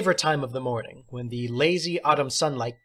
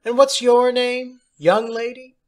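high, louder teacher's voice; theatrical teacher; 11 semitones higher, 8 dB louder than usual. Acted. Substitute teacher praising John, high and theatrical. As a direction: play the odd substitute, grand and pleased.

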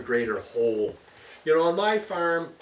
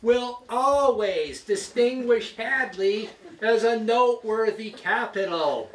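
high, slow, booming campaign speech; pompous mayor; 9 semitones higher, 5 dB louder, slower than usual. Pretend. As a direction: boast like a politician at a rally. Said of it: "it sounds something like this".

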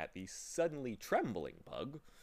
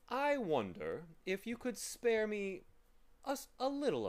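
high, courteous request; polite; 11 semitones higher than usual. A narrator's reading. Gentleman asking for their time, high and polite. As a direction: ask a favor politely, pitch raised. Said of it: "he inquired politely".

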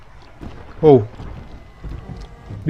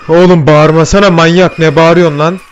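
angry yell; 3 semitones higher, 7 dB louder than usual. Real, not acted. Yelling back at screaming monster.

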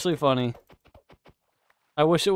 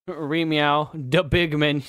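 ordinary talk, nothing marked

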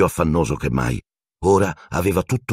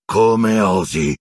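slow, louder growl of outrage; 5 semitones higher, 4 dB louder, much slower than usual. A narrator's reading. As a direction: snarl it like a huge dog. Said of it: "ringhiò".

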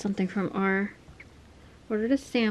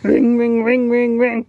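phone ring; 3 semitones higher, 9 dB louder than usual. Pretend. Playing with the banana phone.

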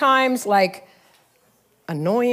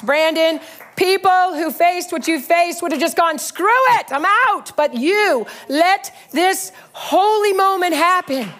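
prophetic preaching; 6 semitones higher than usual. Acted. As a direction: speak it as a word from the Lord over him. Prophetic word delivered to leaders.

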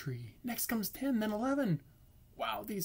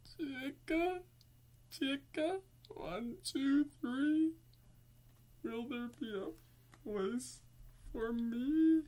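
a touch of crying; 7 semitones higher than usual. Acted. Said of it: "who begins to cry".

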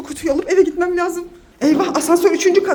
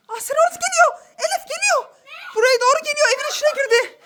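high alarmed excitement; 7 semitones higher than usual. Acted. Playacting the astronaut's approach.

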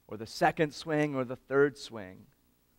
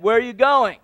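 raised, higher call across the field; pastor calling out; 9 semitones higher, 11 dB louder than usual. Retold or imitated. Pastor calls to the boy walking off the field, loudly. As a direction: shout it from the pitcher's mound. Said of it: "He said".

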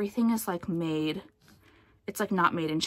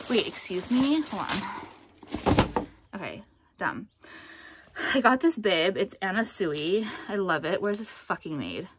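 ordinary delivery

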